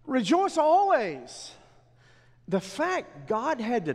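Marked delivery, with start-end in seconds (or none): 0.0-1.5 high, loud and slow, ringing out the command; "Rejoice always" preached higher and louder; proclaimed command. Acted. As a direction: call it out slowly to the whole church. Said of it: "preacher proclaiming the command".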